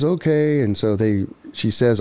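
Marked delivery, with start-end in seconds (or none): 0.0-0.6 reluctant okay. Retold or imitated. Woman agrees to his help, high and hesitant. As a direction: give in reluctantly, pitched up.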